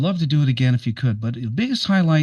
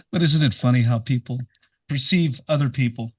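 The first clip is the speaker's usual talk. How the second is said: ordinary delivery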